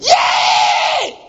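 high, loud mock scream of a gunman; long imitated shriek; screamed yell. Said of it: "the man screamed".

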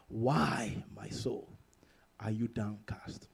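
unvoiced, slow whisper of the plea; hushed whisper. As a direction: breathe the question to your own soul. Rereads plea much softer.

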